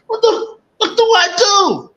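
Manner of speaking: high panicked shouting cry. Retold or imitated. The confused slave crying out in panic, high. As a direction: yelp it out in a high frantic panic. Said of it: "He's like".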